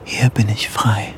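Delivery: inner whisper. Acted. Inner thought on the ledge.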